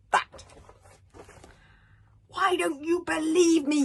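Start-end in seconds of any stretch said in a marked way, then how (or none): 2.3-3.8 high, loud indignant demand; furious son. Acted. Hiccup furiously confronting his father. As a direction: demand it angrily. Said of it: "he said furiously".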